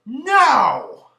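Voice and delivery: a loud, high, barked refusal; retold loud no; sharp no. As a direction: snap it back at him like a cranky old lady. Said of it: "and she goes".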